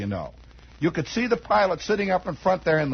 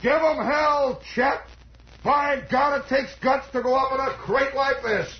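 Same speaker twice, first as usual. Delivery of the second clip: hollered cheer; 7 semitones higher than usual. Retold or imitated. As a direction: yell it up at the plane. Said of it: "One of them hollered up".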